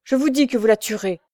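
emphatic, impassioned warning; growing animated. A narrator's reading. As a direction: drive the warning home with heat. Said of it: "Puis s'animant".

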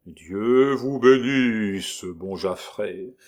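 very slow, hollow graveyard voice; sepulchral voice. Acted. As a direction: intone it from beyond the grave. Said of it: "d'une voix sépulcrale".

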